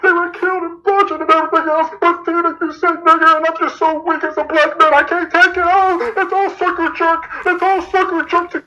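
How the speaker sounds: high, hammed-up tearful wail; mock crying. Pretending to be hurt by what Tina said, mock crying. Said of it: "look at Tina and say".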